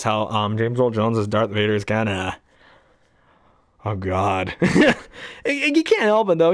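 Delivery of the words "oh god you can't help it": ordinary talk, nothing marked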